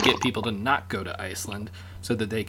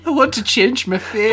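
put-on higher, louder hammy Scottish accent; mock Scottish accent; 9 semitones higher, 9 dB louder than usual. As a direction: ham up a Scottish brogue. Mock accent bit after Scottish remark.

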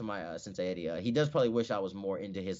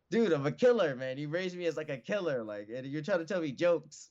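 mock protest; 7 semitones higher than usual. Mock protest to joking coach.

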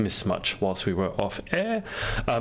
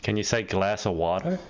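New York "glass of water" with a flat a and r-colored wah-ter; New York accent. Shown as a demonstration. Host shows the guest a New York phrase. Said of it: "That's a very New Yorkan thing".

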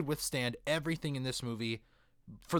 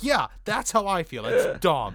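emphatic agreement; 3 semitones higher, 7 dB louder than usual. Retold or imitated. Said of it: "And I'm like".

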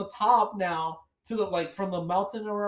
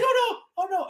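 high dismayed oh no; mock panic; panicked; 9 semitones higher, 4 dB louder than usual. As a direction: moan as death comes. About to die in game.

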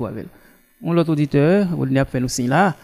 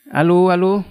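to someone hard of hearing; 4 semitones higher, 5 dB louder than usual. Real, not acted. Caller can't hear the host.

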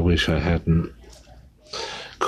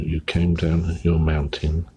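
usual speaking voice

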